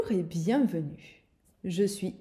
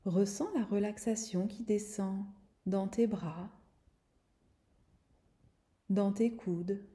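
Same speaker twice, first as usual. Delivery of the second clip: guided meditation; 3 dB quieter than usual. Acted. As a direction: guide her gently into calm. Leads a guided relaxation.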